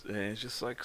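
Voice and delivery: usual speaking voice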